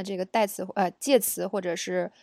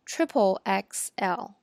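triple X L drawn out slowly; slow model reading; much slower than usual. Shown as a demonstration. Teacher slowly modelling triple X L. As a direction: spell it out slowly for learners. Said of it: "我们说".

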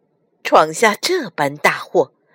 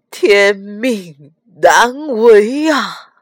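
slow, loud lament; anguished; 5 dB louder, much slower than usual. A narrator's reading. Li Jing pushes the servant away, anguished. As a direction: draw out each word in pained resignation. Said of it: "痛苦地摆了摆手".

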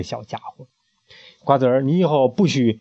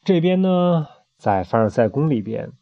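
plain reading voice

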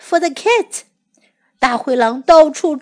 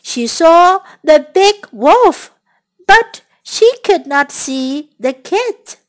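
ordinary delivery

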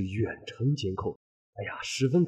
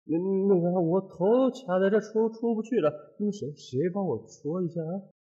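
languid voice; 7 semitones higher than usual. Retold or imitated. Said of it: "头顶飘来他悠悠的声音".

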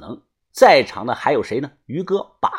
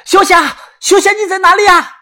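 high, urgent full-voiced shout; loud calling out; 7 dB louder than usual. A narrator's reading. Yuanbao calls for the missing woman, loud shouting. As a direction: call out loudly for someone missing. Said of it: "元宝大声的呼喊".

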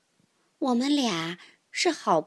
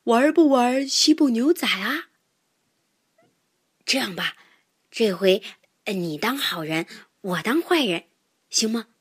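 lightly voiced tiger, low and tentative; big tiger; 3 semitones lower than usual. Tiger trying to win mouse back, lightly voiced, conciliatory. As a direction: offer it hesitantly as a humbled tiger. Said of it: "大老虎又说".

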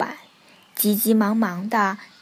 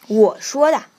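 very loud, forceful answer; bossy retort; 10 dB louder than usual. A narrator's reading. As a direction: claim authority with full volume. Duck asserts authority to frogs. (霸道地大声回答)

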